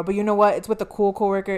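ordinary delivery

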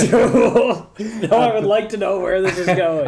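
high and loud, talking through laughter; laughing. Real, not acted. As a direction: laugh out the words, barely holding it together. Cracking up at cat story.

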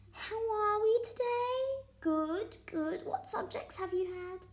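a chirpy teacher breezing into class; English teacher impression. Kid mimics English teacher greeting the class, high sing-song voice. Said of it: "this is my impression of an English teacher".

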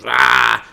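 loud, short mock yell; loud "aah" imitating a charging kid; mock roar of attack; imitated attack yell. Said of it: "Just goes".

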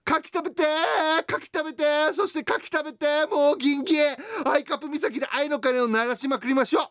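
high, loud, gushing mom character; excited high letter voice. Acted. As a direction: read it as a giddy, pleading mom. Reads letter in mother persona. (興奮した高い声のおかんキャラ)

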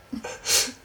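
normal talking voice

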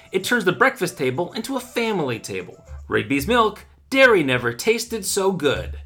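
upbeat radio host pitching milk; bright sponsor read, selling it. Acted.

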